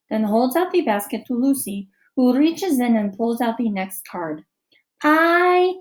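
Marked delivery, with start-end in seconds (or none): 5.0-5.7 slow, higher, louder reading. A narrator's reading. Girl reads her card slowly and proudly. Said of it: "she reads".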